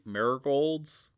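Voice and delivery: ordinary talk, nothing marked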